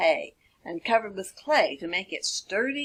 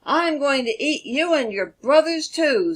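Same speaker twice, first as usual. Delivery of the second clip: menacing wolf voice, a bit higher; hungry wolf; 5 semitones higher, 4 dB louder than usual. Acted. Wolf threatens the pig in a menacing voice. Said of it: "said the hungry wolf".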